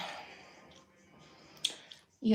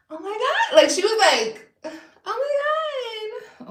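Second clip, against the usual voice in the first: much higher, slow starstruck squeal; star-struck squeal; 7 dB louder, slower than usual. Retold or imitated. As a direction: gush like a fan meeting a celebrity. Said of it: "She's like".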